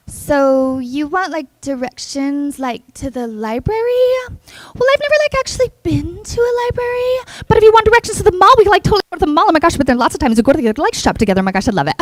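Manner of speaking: hammed-up Valley Girl accent. Shown as a demonstration. GPS asked for library directions, gushing Valley Girl accent. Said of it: "let's do Valley Girl".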